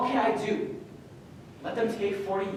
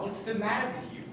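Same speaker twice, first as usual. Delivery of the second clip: normal talking voice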